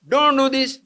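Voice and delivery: put-on firm loud scolding at the children. Shown as a demonstration. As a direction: raise your voice to stop the children. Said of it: "If you raise your voice".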